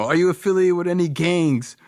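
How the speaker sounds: high-pitched imitation of an alarmed father; questioning dad. Dad asking his son crazy questions, high and urgent. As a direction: interrogate like a dad demanding answers. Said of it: "all these crazy questions".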